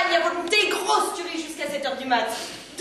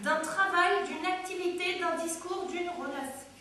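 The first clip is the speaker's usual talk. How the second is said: dictionary recital; 9 dB quieter than usual. Acted. Woman defines the word her partner just used, quiet recital.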